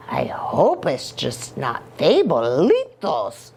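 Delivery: playful little hero with swooping pitch; sing-song, mostly low with leaping peaks; Scapito's character voice. Acted. Scapito introducing himself and musing on Martians, playful character voice.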